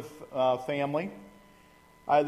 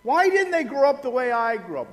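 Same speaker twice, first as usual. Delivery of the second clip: bewildered exasperation; 8 semitones higher, 4 dB louder than usual. Retold or imitated. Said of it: "you're going".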